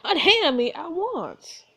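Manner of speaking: high, slow, loud demand; acted outrage, each word drawn out; indignant command. Heroine orders duke to release her.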